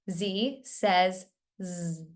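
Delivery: first Z named zee, last Z buzzed zzz; slow, spaced Z says Z, ending in a buzz; name the first Z, buzz the last Z; letter and sound. Shown as a demonstration. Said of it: "saying the letter and the sound".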